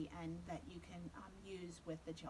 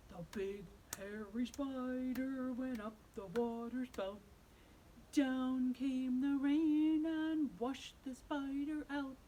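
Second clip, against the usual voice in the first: louder big-spider singing, not truly deep; lightly voiced big hairy spider; 5 semitones higher, 6 dB louder, slower than usual. Shown as a demonstration. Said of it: "now we're gonna get a big, deep voice".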